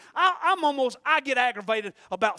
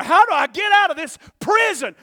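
desperate cry; 9 semitones higher, 16 dB louder than usual. Retold or imitated. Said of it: "you're saying".

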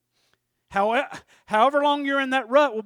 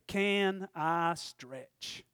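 very slow title; 6 semitones lower, 8 dB quieter, much slower than usual. Shown as a demonstration. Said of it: "So you wanna title this up".

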